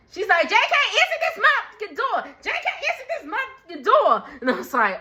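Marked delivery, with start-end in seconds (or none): 0.5-4.2 high, rapid-fire holler; loud demanding yell. Retold or imitated. Brooke banging on the door for J K, loud. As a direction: mimic someone yelling through a door, high and fast. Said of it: "all loud and stuff".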